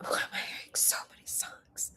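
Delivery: puzzled hushed whisper. Real, not acted. Vlogger whispers, confused by songs in her head. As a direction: breathe it out, baffled.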